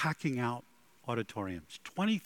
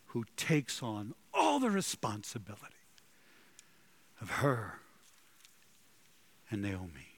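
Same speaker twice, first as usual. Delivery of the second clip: ordinary talk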